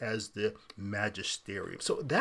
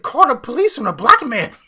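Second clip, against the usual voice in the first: high, very loud outraged yell; hammed-up hysterical complainer shouting for the police; mock Karen shriek; 11 semitones higher, 17 dB louder than usual. Host parodying the archbishop as a Karen, mock shrieking. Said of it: "This is such a Karen response".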